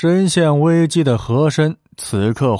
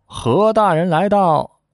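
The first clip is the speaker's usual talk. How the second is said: lightly called, higher-pitched announcement; announcing an arrival; 5 semitones higher than usual. A narrator's reading. Doorman reporting an official's arrival to the host, lightly called out. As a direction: announce it on a held high pitch. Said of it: "突然听到门外报道".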